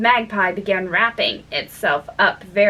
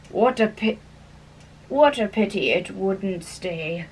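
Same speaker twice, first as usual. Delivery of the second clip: sighing; 3 semitones lower, 3 dB quieter than usual. A narrator's reading. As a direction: sigh it out sadly. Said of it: "sighed the Lory".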